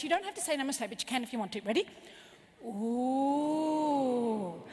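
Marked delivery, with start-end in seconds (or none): 2.6-4.6 very long, swelling ooh; awed ooh. Shown as a demonstration. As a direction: draw out a smooth ooh of awe. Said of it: "we're gonna go like this".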